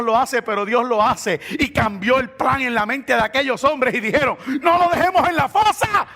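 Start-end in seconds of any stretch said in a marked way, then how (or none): 4.6-6.1 high, loud call to the others; raised preacher's re-enactment; acted urgent shout. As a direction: burst out with the new plan. Men suddenly decide, acted out.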